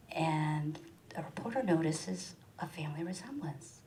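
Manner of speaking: ordinary delivery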